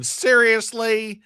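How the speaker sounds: high, slow disbelieving seriously; can't believe what he just read; incredulous. Real, not acted. Reacting to a chat comment, high disbelief.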